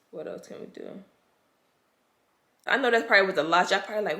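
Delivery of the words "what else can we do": whispered, defeated; whispered despair. Real, not acted. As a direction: let it fall to a whisper. Despairing repeat after sigh.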